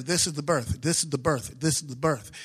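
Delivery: low, measured reminder, hitting THIS each time; emphatic repetition. Shown as a demonstration. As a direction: stress this on every repeat. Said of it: "I gotta remember".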